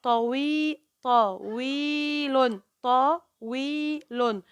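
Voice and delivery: "طويل" very slowly, the ي held long; teacher sounding out "طويل"; syllable by syllable. Shown as a demonstration. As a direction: stretch the middle of "طويل", parts separated. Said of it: "الطاء لوحدها والواو والياء".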